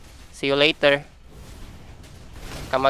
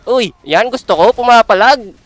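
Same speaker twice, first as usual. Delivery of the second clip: high, loud thrilled yell; excited shout; 6 semitones higher, 9 dB louder than usual. Real, not acted. Gamer thrilled that the enemy fights back, shouting excitedly.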